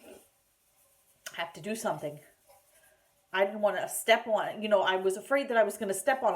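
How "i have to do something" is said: softer, slow inner voice; quiet resolve. Retold or imitated. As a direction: say it softly to yourself, bracing. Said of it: "I'm like".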